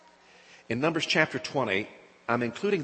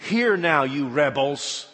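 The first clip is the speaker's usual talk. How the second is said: loud, slow furious rebuke; angry rebuke; 6 dB louder, slower than usual. Acted. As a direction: lash out at the rebels. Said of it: "the anger of it in those words".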